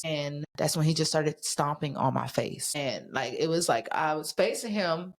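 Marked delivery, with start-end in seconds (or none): none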